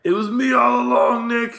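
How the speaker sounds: slow, high hammed-up shout; mock villain reveal. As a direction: crow it like a culprit unmasking himself, loud and drawn out. Mock villain's confession to Nick.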